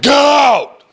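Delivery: loud, high ghostly shout ordering you out; be the haunted building's voice yelling. Retold or imitated. Teacher voicing a ghost behind the door, loud shout. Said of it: "a voice said".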